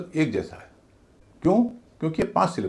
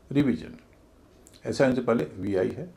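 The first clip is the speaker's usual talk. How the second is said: normal talking voice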